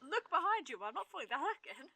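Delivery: high, laughing mock warning; laughing re-enactment. Listener replaying the Sid joke, laughing mock warning. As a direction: quote it back through laughter.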